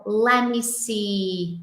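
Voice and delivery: "Let me see" drawn out slowly, lemme linked. Shown as a demonstration. Teacher shows how natives stall, slow linked lemme see. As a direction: let it stretch like thinking aloud. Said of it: "Então".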